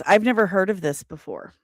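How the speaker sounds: ordinary talk, nothing marked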